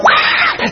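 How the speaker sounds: voiceless mock snake hiss; harsh, noisy snake-strike sound; imitated viper strike. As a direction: imitate fangs springing out. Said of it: "his fang came out".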